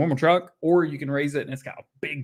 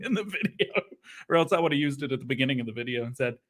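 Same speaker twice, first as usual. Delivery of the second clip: ordinary talk, nothing marked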